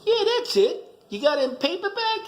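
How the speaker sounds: hammed-up eager Archie; Archie punchline. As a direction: pop up high, delighted and dense.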